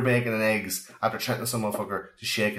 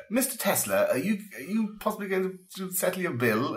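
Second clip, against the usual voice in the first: higher, timid polite clerk voice; hotel clerk; 10 semitones higher than usual. Retold or imitated. Host voicing a hotel clerk asking Tesla to pay, timid high voice. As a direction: ask nervously about the unpaid bill. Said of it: "'cause he's like".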